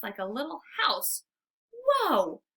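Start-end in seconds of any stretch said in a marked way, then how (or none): 1.7-2.4 surprised. A narrator's reading. Dog sees squirrel house.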